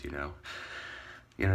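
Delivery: normal talking voice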